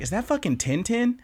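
high, incredulous question; surprised. Retold or imitated. Seeing the character's head from behind, high and incredulous. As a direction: ask in disbelief. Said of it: "I was like".

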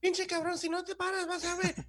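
high-pitched, hammed-up threat in Spanish; mock Mexican scolding. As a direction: scold in a squeaky, put-on rant. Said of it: "the way Mexicans answer phone calls".

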